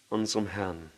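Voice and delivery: normal talking voice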